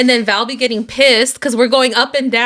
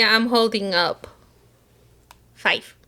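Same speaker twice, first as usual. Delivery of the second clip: ordinary delivery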